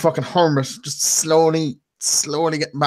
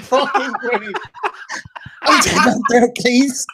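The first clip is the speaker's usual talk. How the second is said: laughing through it, pitched up high; 8 semitones higher, slower than usual. Retold or imitated. Retelling the whiskey price to a friend, laughing. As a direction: chuckle out the words in mock delight.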